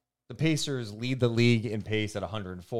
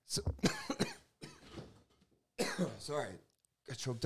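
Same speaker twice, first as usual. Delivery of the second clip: hoarse, cracking voice; voice giving out; 4 semitones higher, 13 dB quieter than usual. Real, not acted. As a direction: voice cracking after a cough. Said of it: "your voice is, like, almost gone".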